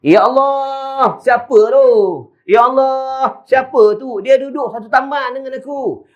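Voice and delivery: high, wondering cries of astonishment; bewildered amazement. Retold or imitated. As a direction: voice the awed neighbours, pitched high. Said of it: "mereka pelik dan mereka terpinga-pinga".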